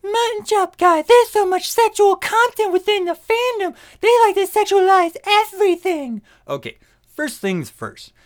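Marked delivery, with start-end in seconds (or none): 0.0-6.2 high, loud whiny complainer; mocking critic voice. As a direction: squeak it out like a nagging critic. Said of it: "people are gonna get on my case".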